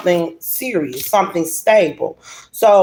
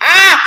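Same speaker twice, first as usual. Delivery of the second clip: high, loud laughing "Ah!"; delighted laughing shriek; 10 semitones higher, 7 dB louder than usual. Real, not acted. Reader thrilled her reading is confirmed, laughing shriek. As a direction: burst out laughing, pitched way up.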